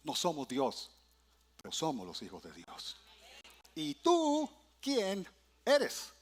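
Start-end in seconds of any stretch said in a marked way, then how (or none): 3.7-6.1 slow questioning. Acted. Acts the priests' question.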